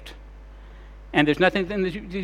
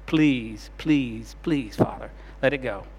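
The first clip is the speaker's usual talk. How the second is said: a mild plea for Jesus, a touch lower; repeated please, understated and pleading; Jesus begs the Father a third time, mildly pleading; begging; 4 semitones lower, 3 dB quieter, slower than usual. Acted. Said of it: "and now he's begging".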